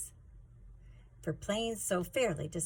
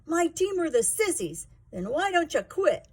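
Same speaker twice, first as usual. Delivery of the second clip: higher and louder bully's tantrum; screaming in a fit; 10 semitones higher, 7 dB louder than usual. A narrator's reading. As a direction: yell it in a childish rage. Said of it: "Sleater screamed in a fit".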